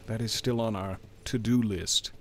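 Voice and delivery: ordinary delivery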